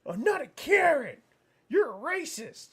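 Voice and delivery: high, loud mock shriek of protest; indignant retort. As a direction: mimic an outraged woman yelling back. Retold retort of an indignant woman.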